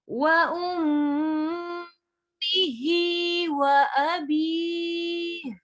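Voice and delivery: slow, melodic tajweed chanting; Quran recitation. Shown as a demonstration. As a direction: draw the vowels out in a slow recitation.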